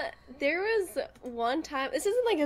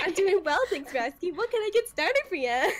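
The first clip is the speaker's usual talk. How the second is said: raised, chirpy order-taker voice; fake customer-service cheer; 5 semitones higher than usual. Shown as a demonstration. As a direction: lift the pitch to sound extra nice. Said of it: "tell you to sound like that".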